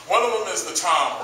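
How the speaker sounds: normal talking voice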